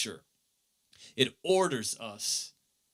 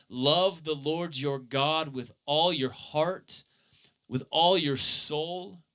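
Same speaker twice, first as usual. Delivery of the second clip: solemn scripture recitation; 5 semitones higher than usual. Acted. Scripture recited slowly, building.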